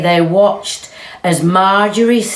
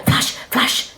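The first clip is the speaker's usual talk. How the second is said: whispered camera flashes. Acted.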